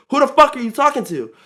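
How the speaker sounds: loud, high retold challenge; rebellious comeback. Teen talking back to coworker, retold angry challenge. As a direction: raise the voice in sharp challenge.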